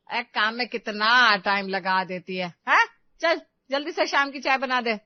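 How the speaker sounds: loud, impatient acted scolding; scolding mother-in-law. Mother-in-law demanding her evening tea, loud and impatient. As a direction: order the daughter-in-law about loudly.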